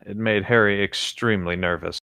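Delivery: usual speaking voice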